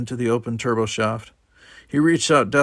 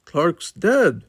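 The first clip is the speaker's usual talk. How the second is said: higher, dazed repetition of the news; stunned in shock; 7 semitones higher, 4 dB louder than usual. A narrator's reading. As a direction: repeat it, numb and shaken. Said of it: "Simon was saying again".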